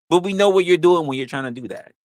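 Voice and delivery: ordinary delivery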